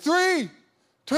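rising crowd count, loud and high; crowd countdown. Retold or imitated. Arena crowd counting the clock down to Steph, loud and high. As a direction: count down the last seconds like a whole arena.

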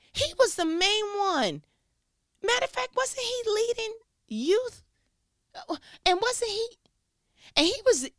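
very high-pitched, incredulous questioning; scandalized disbelief. Acted. Church member recalling who was leading youth, scandalized disbelief.

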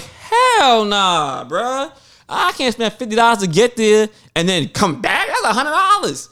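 high-pitched, drawn-out mock outrage; incredulous protest. Retelling his shock at a fifty-dollar Uber ride, incredulous. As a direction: balk at the price in exaggerated disbelief.

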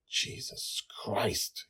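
slow, relieved whisper. Acted. Father relieved after the near miss, whispered. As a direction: breathe it out in shock. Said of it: "he whispered".

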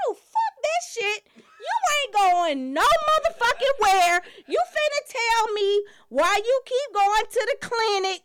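furious wife. Pretend. Pretend wife yelling at husband about leaving town. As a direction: let it rip like a wife who won't be played.

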